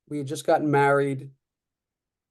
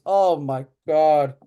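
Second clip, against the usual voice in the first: slow, drawn-out, louder gasp of worry; nervous oh my God; 6 dB louder, much slower than usual. Retold or imitated. Store clerk fretting over a golf novice, slow nervous groan. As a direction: stretch it out like you're dreading it for him. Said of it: "more nervous than I was".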